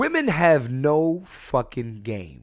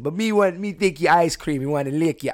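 badly faked West Indian come-on; horrible West Indian accent; 4 semitones higher than usual. West Indian man hitting on a woman, badly faked West Indian accent. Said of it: "that's a horrible accent".

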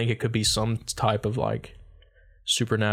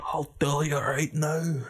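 slow, higher mock Scottish; Scottish accent; 4 semitones higher, 3 dB quieter, slower than usual. Trading Scottish impressions, slow high brogue. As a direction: drag it out like a wild Scot. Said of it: "when I do a Scottish".

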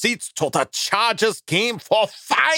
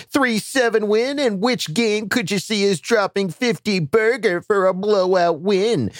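ordinary delivery

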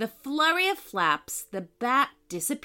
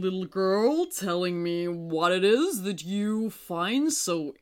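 courteous moon character; 2 semitones lower than usual. A narrator's reading. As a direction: ask politely as a stately old moon.

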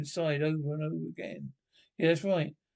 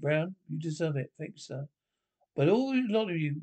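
usual speaking voice

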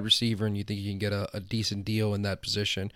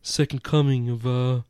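lightly voiced stoner dude; 5 dB louder than usual. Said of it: "that's my default voice".

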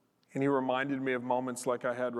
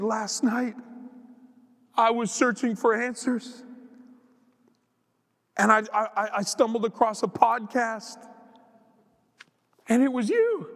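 high, emotional retelling of a crying woman; weeping seatmate; 7 semitones higher, 4 dB louder than usual. Retold or imitated. Preacher retells weeping woman finding his podcast, mock tearful. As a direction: imitate her tearful disbelief, pitched up. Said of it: "She's weeping. She's crying".

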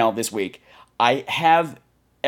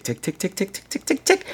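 playful ticking countdown; ticking clock; 8 semitones higher, 4 dB quieter than usual. Shown as a demonstration. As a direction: tick tick, fading to a whisper then a squeak. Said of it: "the clock is going tick".

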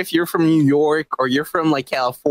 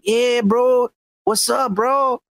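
mock Mexican-American accent; 6 semitones higher, much slower than usual. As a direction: put on an exaggerated Chicano "bro" accent. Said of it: "talks like that".

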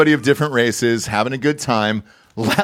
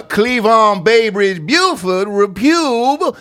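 put-on slow, high Old South drawl; Old South accent; 7 semitones higher, much slower than usual. Shown as a demonstration. Showing the Old South accent he wants, long drawl. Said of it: "I always want the Old South accent to come out".

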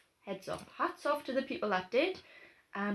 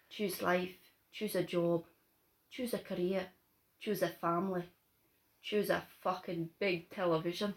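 lightly Scottish Trainspotting speech, lower and softer; Scottish accent; 6 semitones lower, 7 dB quieter than usual. Acted. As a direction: recite the Choose Life speech in a mild Scottish accent. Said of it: "do each other's accents".

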